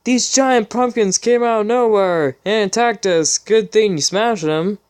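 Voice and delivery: high, lightly acted little girl; high, hammed-up filly line; girlish filly voice. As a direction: squeak it like a young filly. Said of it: "Sweetbelle spoke up".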